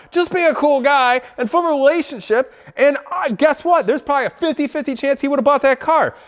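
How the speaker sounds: high-pitched, insistent scolding; exasperated lecture. Retold or imitated. Customer lecturing absent salesman on forming relationships, exasperated. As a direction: lecture him in exasperated disbelief.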